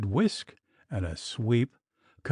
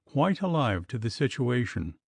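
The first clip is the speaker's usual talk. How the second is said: plain reading voice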